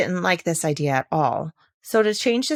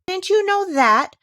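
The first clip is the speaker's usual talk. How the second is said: very high, louder, slow daisy cry; a daisy squealing in scorn; shrill mocking daisy cry; 13 semitones higher, 6 dB louder than usual. A narrator's reading. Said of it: "cried another daisy".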